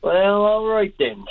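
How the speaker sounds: very high, drawn-out Southern Shore twang. Retold or imitated. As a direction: imitate her slow, high Southern Shore agreement. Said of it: "in her Southern Shore twang".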